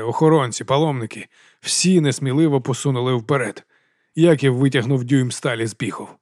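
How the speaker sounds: ordinary delivery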